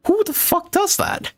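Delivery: who would ever do that, utterly baffled; outraged disbelief. Real, not acted. Streamer reacting to the family abandoning her: outraged disbelief. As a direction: pitch jumps way up in real shock.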